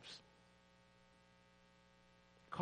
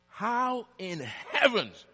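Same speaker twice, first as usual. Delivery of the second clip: incredulous outburst; 9 semitones higher, 12 dB louder than usual. Retold or imitated. Preacher demanding an answer from God, loud and incredulous. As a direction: throw the question at heaven in disbelief.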